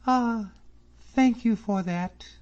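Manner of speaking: high, slow, grateful; grateful girl. A narrator's reading. Miss Drummond thanking David, high and slow. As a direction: thank him sincerely.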